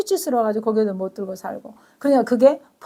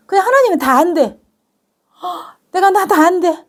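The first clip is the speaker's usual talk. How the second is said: excited awe; 5 semitones higher, 5 dB louder than usual. Acted. Voicing God's reassurance with gasps.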